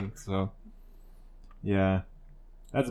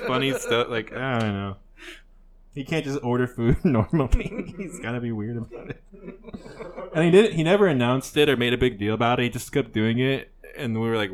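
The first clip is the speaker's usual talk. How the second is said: ordinary delivery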